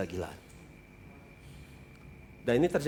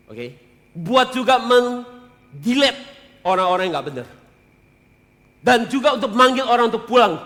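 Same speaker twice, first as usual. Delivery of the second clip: loud, high, urgent preaching; fiery preaching; 7 semitones higher, 7 dB louder than usual. Acted. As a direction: preach it with full force to the congregation. Preaching at full force.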